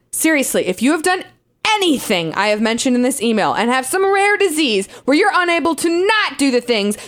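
normal talking voice

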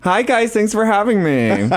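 high, bright feminine drag voice; drag queen voice. Real, not acted. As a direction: greet them in your drag persona. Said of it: "Is that your drag queen voice".

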